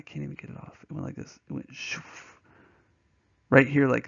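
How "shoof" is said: soft, voiceless "shoof"; imitated whoosh. Teacher showing his ring slipping off, soft whoosh. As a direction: whoosh like something slipping away.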